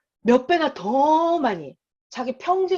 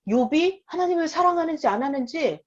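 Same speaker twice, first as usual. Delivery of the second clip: normal talking voice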